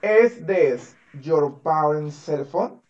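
slow, word-by-word question; drawn-out teacher's demonstration, word after word; modelled English question. Shown as a demonstration. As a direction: model it slowly for a learner. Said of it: "Así".

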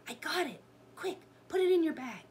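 high, quick and breakneck; hurried storybook heroine; urgent excitement. A narrator's reading. Annie urgent during escape.

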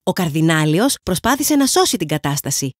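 ordinary delivery

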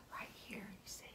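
very soft whispered pointing; hushed pointing. Pretend. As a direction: whisper as if not to wake it. Pointing out seed softly.

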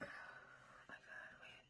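slow sighing whisper. Real, not acted. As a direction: breathe it out in a whisper. Sigh, whispering before bite.

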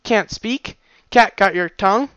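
plain reading voice

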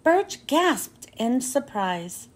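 plain reading voice

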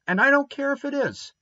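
put-on defiant boy talking back to his sister; reckless retort. A narrator's reading. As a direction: fling it back without caring. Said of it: "retorted Davy recklessly".

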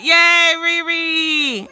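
loud, high, drawn-out cheer. Real, not acted. Parent cheering child's jump.

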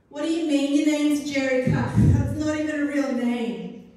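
playground bully yelling, high and loud. A narrator's reading. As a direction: jeer it at the top of your lungs. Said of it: "he yelled in the playground".

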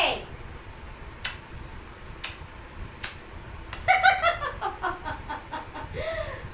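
airy unvoiced burst of laughter; breathy laugh. Real, not acted. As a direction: laugh out while cuddling. Laughing while kissing pet. (হাসিতে ফেটে পড়া)

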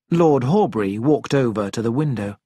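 usual speaking voice

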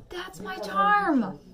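slow, high, loud drawn-out cry; exaggerated proud exclamation. Echoing friends' compliment about herself, hammed-up proud exclamation. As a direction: belt it out, stretching the last word.

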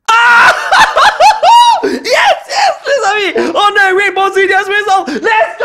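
very high, loud screams broken by laughter; ecstatic screaming. Real, not acted. Player pulls a rainbow rare, ecstatic screaming to friends. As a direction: scream the big win in triumph.

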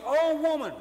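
high, loud Oh woman; Jesus praising her faith, excited call; excited exclamation. Acted. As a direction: cry out in delight. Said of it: "when they're excited".

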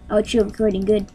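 ordinary talk, nothing marked

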